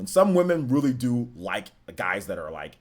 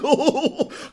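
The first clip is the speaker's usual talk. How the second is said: high laugh; 13 semitones higher, 5 dB louder than usual. Real, not acted. Laughing at own story.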